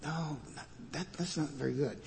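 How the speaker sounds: hushed, hesitant mock protest; worried onlooker's aside. Onlooker cautioning Jesus, hushed worried aside. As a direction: stammer a soft word of caution under your breath. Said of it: "I would have said if I'd been there".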